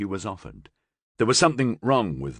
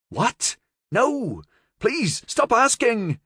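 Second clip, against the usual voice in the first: panicked protest; 4 dB louder, slower than usual. Acted. Cornered nephew begs her stop.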